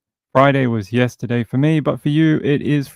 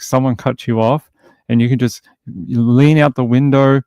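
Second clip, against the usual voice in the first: ordinary talk, nothing marked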